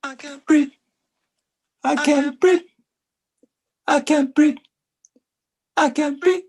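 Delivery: high, loud pleading cry, again and again; desperate cry. Acted. Poet opens his slam piece with a high, loud cry. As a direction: gasp it out like you're choking. Performed slam poem opening.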